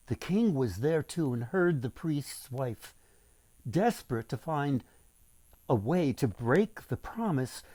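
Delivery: usual speaking voice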